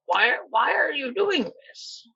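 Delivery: ordinary talk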